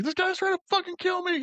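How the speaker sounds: mock high-pitched squeal of panic. Jumper complaining after the fall, squeaky mock panic. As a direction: squeak it out like a freaked-out cartoon.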